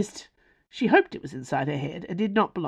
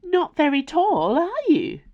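high, loud accusation; accusing; 10 semitones higher, 4 dB louder than usual. A narrator's reading. Furniture sizing up a visitor, accusing. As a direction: pipe up with a pointed, high-pitched jab. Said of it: "it accused".

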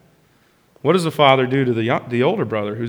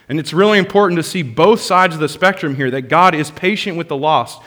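ordinary talk

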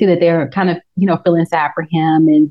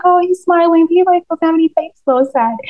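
high mock pity; 10 semitones higher than usual. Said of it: "And I'm like".

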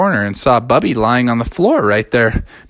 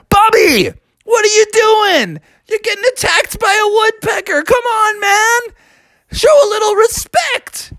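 scolding bear dad. Acted. Dad bear scolds Bubby for being pecked. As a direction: shout down at your son.

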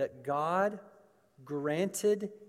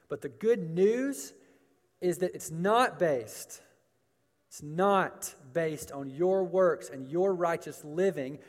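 raised, ringing preacher's delivery; emphatic preaching; 2 semitones higher, 5 dB louder than usual. Real, not acted. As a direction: preach it with rising conviction. Preaches at full force.

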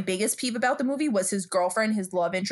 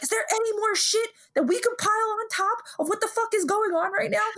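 high-pitched mock exasperation, imitating him; pile-on complaint thrown up high in disbelief; exasperated rant; 12 semitones higher than usual. Joe Pesci's exasperated rant retold.

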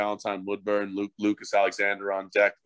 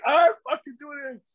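pitched way up, cartoonish innocent protest; mocking high voice; 4 dB louder than usual. Player mocking the referee's accusation in a high squeak. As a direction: squeak it out like a falsely accused kid.

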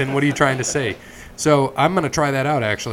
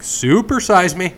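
mock greedy shopper; 6 semitones higher, 4 dB louder, slower than usual. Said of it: "I'm like".

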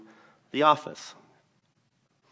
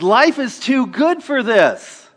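much louder, higher, slow plea; retold shout; 13 semitones higher, 12 dB louder, slower than usual. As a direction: shout him down with conviction. Retold calming shout at angry man.

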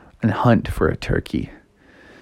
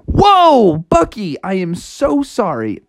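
shocked, higher-pitched sorry; startled apology; 7 semitones higher, slower than usual. Acted. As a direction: blurt it out, then apologize earnestly. Frog apologizes after near miss.

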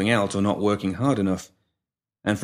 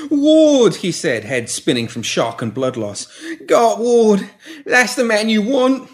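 desperate; 13 semitones higher, 6 dB louder, slower than usual. A narrator's reading. Pimp naming his boss.

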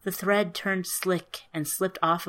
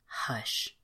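soft unvoiced hush, much softer; 5 semitones lower, 7 dB quieter than usual. Fairy calming frightened Orin, soft whisper. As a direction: breathe it soothingly.

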